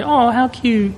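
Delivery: put-on high, sing-song baby talk to a little kid; mock cooing. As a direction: coo it like over a cute toddler. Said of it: "pinch a little kid's cheek and say".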